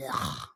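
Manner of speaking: deep, soft, weary ugh; low groan. Retold or imitated. To herself on a low morning, deep weary groan. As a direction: sink into a low tired groan. Feeling mentally low yesterday.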